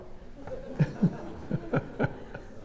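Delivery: airy laugh. Real, not acted. Laugh after the punchline.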